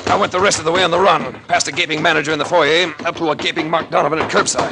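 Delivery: loud, driving narration on the run; breathless chase narration. A narrator's reading. Detective recounting his escape to the car, loud and urgent. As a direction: tell it like you are still running.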